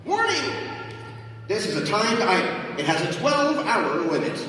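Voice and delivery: high, bright system announcer; game announcer voice. Acted. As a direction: announce it like a game show host.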